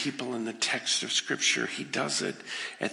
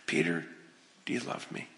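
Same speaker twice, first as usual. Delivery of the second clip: quiet third asking; 9 semitones lower, 8 dB quieter than usual. Acted. Jesus asks the third time.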